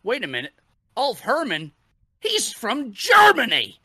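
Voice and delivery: announcer building from puzzled to loudly shocked; mock shocked announcer. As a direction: play a commentator startled by a ridiculous fact. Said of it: "a quote from Joey Styles".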